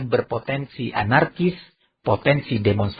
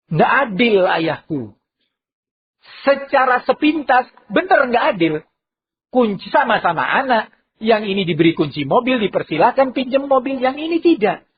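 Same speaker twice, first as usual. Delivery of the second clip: petulant son crying unfair, voice raised high; protesting son; 8 semitones higher than usual. Acted. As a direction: complain that it's not fair. Naughty son protests to father.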